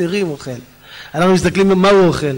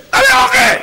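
very high, demanding shout; hammed-up high-pitched bellow; yelling for food; 4 dB louder than usual. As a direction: holler it like a famished giant eater. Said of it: "ככה הוא צועק".

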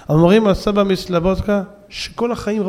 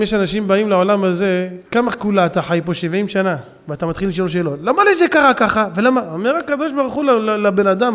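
usual speaking voice

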